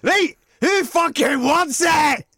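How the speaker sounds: slow, high, roaring challenge; hard-man shout. Pretend. Host imagines Jojo challenging everyone post-fight, roaring. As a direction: bellow it to the whole arena. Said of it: "Do a turn and say".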